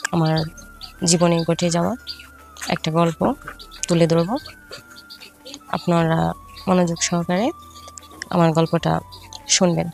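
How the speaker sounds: ordinary delivery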